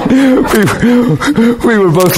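high, drawn-out words through laughter; real laughing. Real, not acted. Preacher admitting he was kidding to the congregation, laughing. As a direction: laugh helplessly as you take back the joke. Laughing at his own joke.

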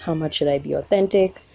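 normal talking voice

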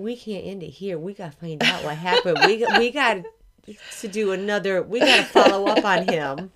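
loud, half-laughing insistence; building from normal volume to a loud, laughing demand; excited protest. Real, not acted. Mock protest at story ending.

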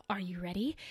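breathy teasing whisper. Acted. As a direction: lean in and whisper it. Teasing the listener before topic.

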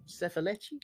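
"Sei felice?" rising on felice; question tune. Shown as a demonstration. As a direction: lift "felice" up at the end.

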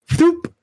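high popping thump; grenade thump sound. Retold or imitated. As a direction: imitate the grenade launcher firing. Imitating grenade launcher.

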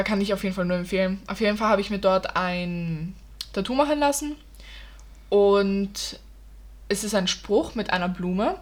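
ordinary delivery

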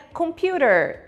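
"computer" in an American accent with a flapped t and a strong final r. Shown as a demonstration. Teacher demonstrating American "computer", final r sounded. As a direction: say "computer" the American way, ending on a hard r. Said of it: "Esse er no final é uma característica do sotaque americano".

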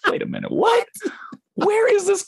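very high-pitched baffled protest; incredulous. Retold or imitated. Voice actor reacting to a nonsensical script, incredulous. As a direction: react in hammed-up disbelief, pitch shooting up.

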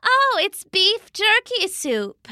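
high, slow Mama voice; breezy Mama. A narrator's reading. Mama tells Papa what the soup is, breezy. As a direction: answer breezily, pitched well up.

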